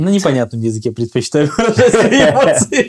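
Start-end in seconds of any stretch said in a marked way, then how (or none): 1.5-2.9 chuckling through the joke; laughing. Real, not acted. Teasing a friend about dialect, laughing as he talks. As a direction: let a laugh break into the words mid-line.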